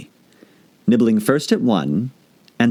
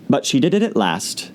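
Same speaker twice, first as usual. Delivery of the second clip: ordinary delivery, nothing marked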